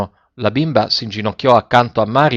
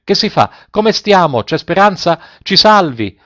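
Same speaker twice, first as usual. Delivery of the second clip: high, louder, frantic questions; panicked pleading; 7 semitones higher, 4 dB louder than usual. A narrator's reading. Passengers begging the captain during the storm, panicked. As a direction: beg to be saved.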